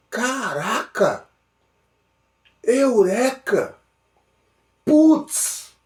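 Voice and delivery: slow, high, drawn-out cries of discovery; amazed exclamations. Pretend. Listener hit by a revelation, high amazed cries. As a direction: gasp it out like a sudden revelation. Acting out a sudden realization.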